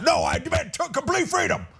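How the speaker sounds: high-pitched, hammed-up Southern holler; mock Southern accent. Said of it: "what's up with the Southern accent".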